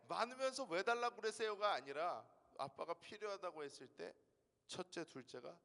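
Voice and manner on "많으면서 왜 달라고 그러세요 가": confident retort. Retold or imitated. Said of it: "첫째는 당당하게 얘기합니다".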